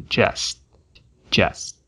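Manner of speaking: just com o T preso, sem soltar o ar; T de just pausado. Shown as a demonstration. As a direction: pare a língua no T de just. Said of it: "a letra T pausada". (o T final de just fica retido)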